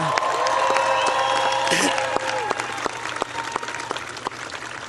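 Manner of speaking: a dry cough mid-sentence; cough, then carry on. Real, not acted. Cough breaks the speech.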